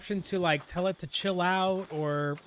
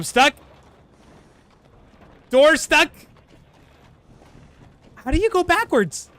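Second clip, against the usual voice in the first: frustrated yelling; 9 semitones higher, 7 dB louder than usual. Real, not acted. Wagon stuck, can't reverse.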